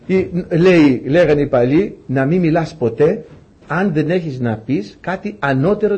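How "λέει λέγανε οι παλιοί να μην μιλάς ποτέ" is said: usual speaking voice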